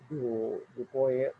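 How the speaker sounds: ordinary talk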